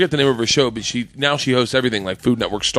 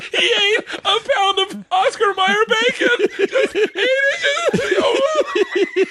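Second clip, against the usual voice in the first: high, slow words squeezed out through laughter; helpless, squealing laughter-talk; real laughing; much slower than usual. Real, not acted. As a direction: gasp out the punchline while cracking up. Cracking up at own punchline.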